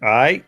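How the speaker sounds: "Aye" as all right with its consonants dropped; Baltimore "all right". Shown as a demonstration. Irishman imitating The Wire's Baltimore speech, "Aye" without consonants. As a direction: slur all right down to a bare vowel, "Aye". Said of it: "that word contains no consonants".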